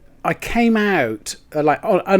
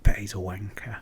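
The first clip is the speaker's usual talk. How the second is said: whispered inner thought, soft and low; 7 semitones lower, 10 dB quieter than usual. Retold or imitated. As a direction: mutter it under your breath as a private thought. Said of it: "and I thought".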